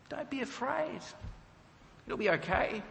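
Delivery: high, soft and breezy reassurance; cool and calm. Retold or imitated. Saul calmly soothing the terrified witch, soft and high. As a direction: soothe her lightly, a bit too easily. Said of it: "he's cool, calm, and collected".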